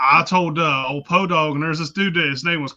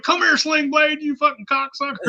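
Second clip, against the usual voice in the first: high, playful taunt; mock high-pitched teasing call; 11 semitones higher, 3 dB louder than usual. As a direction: taunt him while chasing with a spider. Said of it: "I'd be like".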